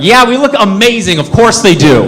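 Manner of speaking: a touch of hammed-up self-satisfaction; proud comeback. Friend answering worried buddy, emphatic mock pride.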